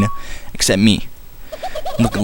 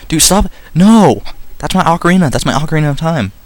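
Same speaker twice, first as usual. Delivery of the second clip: alarmed protest; 6 semitones higher than usual. Pretend. Link protests his ocarina being taken.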